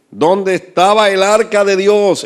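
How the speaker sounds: a high, loud, slow rhetorical question; preaching shout. Real, not acted. Preacher quizzing his congregation about the ark, loud and slow. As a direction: drive the question home to the pews.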